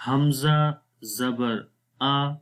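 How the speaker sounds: teacher sounding out حمزہ زبر آ letter by letter; slow spelling drill. Shown as a demonstration. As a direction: spell حمزہ زبر آ slowly, each part clear. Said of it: "تو شروع کرتے ہیں۔". (آہستہ ہجے کر کے پڑھنا)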